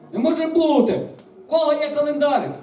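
loud, high cries of disbelief; disgruntled crowd. A narrator's reading. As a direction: exclaim like dismayed voices in a crowd. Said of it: "невдоволений гомін".

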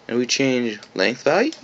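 usual speaking voice

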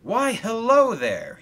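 high, loud, slow hello; cheerful greeting. Acted. Engine looks over and greets Thomas, cheerfully. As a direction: greet them with hearty good cheer. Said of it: "he said cheerfully".

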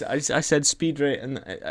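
normal talking voice

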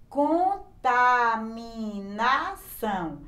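"Contaminação" very slowly, every syllable set apart. Shown as a demonstration. Teacher showing a bigger word, separated syllables. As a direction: stretch "Contaminação" out as con, ta, mi, na, ção. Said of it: "quatro ou mais sílabas".

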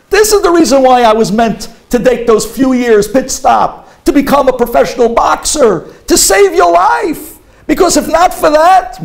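loud high proud declaration; triumphant exclaiming. Retold or imitated. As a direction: proclaim it to your father, fired up. Son exclaiming to father after fight.

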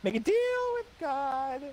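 silly high voice. Pretend. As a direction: stretch it out in a goofy voice. Same joke voice repeated.

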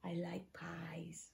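secretive whisper to the class. Acted. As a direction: share it like a little secret. Teacher confides to class.

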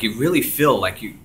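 normal talking voice

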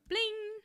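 bright "plim" sung out like a bell; high ding to move things along; imitated chime. Shown as a demonstration. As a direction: make a high chime sound. Chime noise moving to next question.

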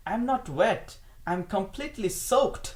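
loud, high, emphatic correction; emphatic protest. Acted. German corrects the woman's question, loud emphatic protest.

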